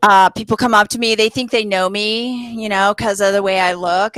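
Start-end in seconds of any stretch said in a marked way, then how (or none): none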